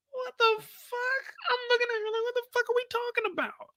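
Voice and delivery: shocked, can't believe what he's hearing; stunned disbelief. Real, not acted. Disbelief at mama's question.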